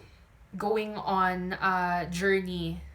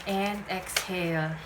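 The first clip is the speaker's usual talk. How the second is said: slow, calm breathing cues; guided breathing. Acted. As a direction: lead the breath gently. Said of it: "please inhale with me".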